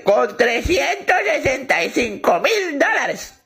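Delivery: put-on a slow, sweet old lady. Old lady telling the cashier, slow and high.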